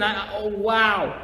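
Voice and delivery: ordinary talk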